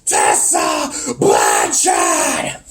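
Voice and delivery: low, drawn-out roar; mock raging roar. Wrestler in his teammate's face, mock raging roar. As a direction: stretch each syllable into a growled shout. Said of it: "shanks him to his face and says".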